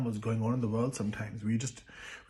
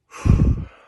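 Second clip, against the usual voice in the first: loud, heavy exhale; heavy sigh; 15 dB louder than usual. Real, not acted. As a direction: let out a big, heavy sigh. Overwhelmed while telling a moving story.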